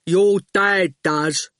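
slow, dim-witted big man; a placid lump of a henchman; slow thick goon voice; strong daft voice. A narrator's reading. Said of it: "He spoke in a strong, daft voice".

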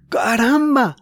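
high, amazed exclamation; girl amazed; astonished. Acted. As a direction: burst out in surprise. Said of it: "dijo Jorge".

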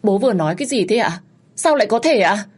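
rising shocked questions; disbelief. Acted. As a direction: reel from the confession. Daughter disbelieves father's confession.

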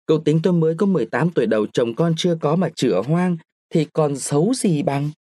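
quick, lightly voiced girl protesting; young woman protesting. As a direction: brush off an old suitor fast. Nguyệt refusing old master. (giọng cô gái phản đối nhanh)

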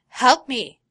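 put-on a falling boy yelling for help; desperate cry for help. Boy yells for help while falling, scared shout.

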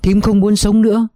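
put-on a broken old woman begging to be punished; distraught pleading. A narrator's reading. Aunt begs, body shaking. (bà thím van lơn trong tuyệt vọng)

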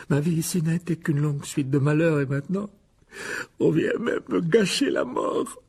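self-pity rising into a high wail; tearful lament. Acted. Self-pitying ghost laments her death. (lamentation larmoyante qui monte dans l'aigu)

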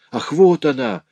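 high, ecstatic exclamation; rapturous outcry. A narrator's reading. Delirious notary seeing the vision of Cecily, rapturous. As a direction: cry out at the sight of her.

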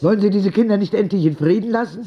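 Oma Rosa scolding. Acted. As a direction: tell the nurses off sharply as Oma Rosa. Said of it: "sagte Oma Rosa". (empörte alte Dame, höher und schnell)

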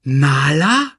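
loud, rising questioning name; surprised recognition. A narrator's reading. Simba recognises his old friend, surprised question. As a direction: ask the name in dawning recognition.

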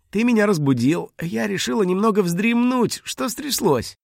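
put-on high, airy girl's voice; casual, high-pitched pretence; feigned sleepy innocence. A narrator's reading. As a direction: act innocently just woken. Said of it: "Она зевнула и смешно причмокнула".

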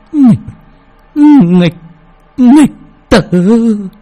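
high, strained dying gasp of a curse; dying old woman. A narrator's reading. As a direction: choke out the curse with your last breath. Dying mother curses her son.

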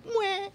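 tiny high strangled mew; gummed-up kitten mew. Acted. Kitten with marzipan-gummed mouth, strangled mew. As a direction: squeak out a failed little mew. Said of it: "When he tried to mew".

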